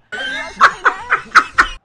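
sudden high loud laugh; loud burst of laughter. Real, not acted. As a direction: crack up at the screen.

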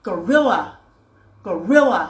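"gorilla" said alone with a hard g, falling at the end; "gorilla" as a careful, isolated example word; model pronunciation. Shown as a demonstration. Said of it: "อย่างเช่นคำว่า".

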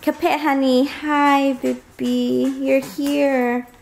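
high, sweet pet talk. Real, not acted. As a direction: coo at the dogs. Greeting the pets.

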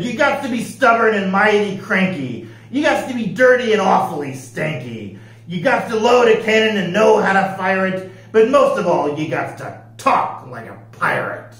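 loud, declaimed pirate voice; declaring pirate captain. Acted. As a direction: lay down the rules like a pirate captain. Pete recites pirate rules.

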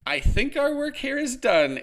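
high, loud over the crowd noise; shouted. Acted. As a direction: shout it into his ear. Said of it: "Amy shouted into Adam's ear".

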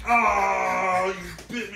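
high, drawn-out cry of pretend hurt; hammed-up wounded yelp; mock pain. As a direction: wail as if just bitten. Batman bitten in play fight.

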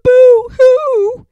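high, loud sobbing wail; mock sobbing. A narrator's reading. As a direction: wail boo-hoo like scared kids. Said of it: "The girls and Pepito cried".

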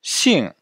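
xing dropping sharply from high; falling-tone xing. Shown as a demonstration. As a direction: teach xing with a steep fourth tone. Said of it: "Répétez après moi". (xing au quatrième ton, qui tombe de haut)

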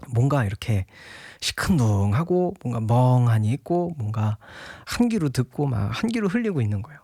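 ordinary talk, nothing marked

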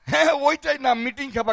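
ordinary delivery

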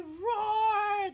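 loud, high mock roar for the kids; playful dinosaur roar. Teacher roars like a dinosaur.

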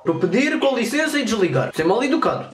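put-on broken-Portuguese Russian accent. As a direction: play a lost Russian, heavy accent. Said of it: "com a famosa voz de russo".

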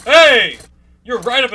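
very loud, high "hey"; startled shout. Real, not acted. Player with animatronic right up in his face, startled shout. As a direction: shout at a sudden intruder.

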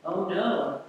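ordinary delivery

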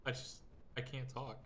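very soft, held-back talking; hushed voice. Real, not acted. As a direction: keep it way down so the mic stays calm. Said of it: "I have to talk like this".

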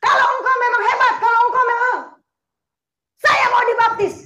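loud, high challenging shout; defiant challenge. Retold or imitated. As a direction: dare God at full volume. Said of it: "saya tantangin tuh Tuhan".

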